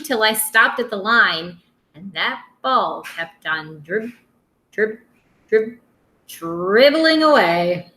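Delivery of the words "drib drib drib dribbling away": slow broken drib- drib- drib- bouncing; stuttering drib-drib. Acted. Reader mimicking the runaway ball, slow and stuttering. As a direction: let the word bounce away like the ball.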